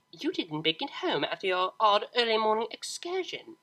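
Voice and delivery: lightly voiced scolding mother, high and cold; a hint of a haughty, controlling mother; condescending mother. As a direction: talk down to her daughter. Mother figure voiced in story.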